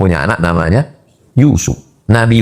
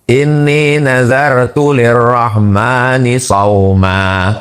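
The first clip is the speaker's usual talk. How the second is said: Quran recitation; 2 semitones higher, much slower than usual. Acted. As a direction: recite the verse with a slow melodic line. (tilawah Quran yang lambat dan bernada)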